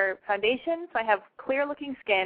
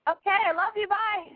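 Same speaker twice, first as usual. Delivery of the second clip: voice cracking; 9 semitones higher than usual. Real, not acted. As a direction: voice cracks up high on the goodbye. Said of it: "my voice just cracked".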